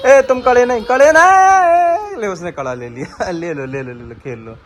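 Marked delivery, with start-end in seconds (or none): 0.1-2.2 high, loud, stretched out नहीं; loud playful protest; calling out. Real, not acted. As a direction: shout to stop her, dragging the word. Child grabs the bangles.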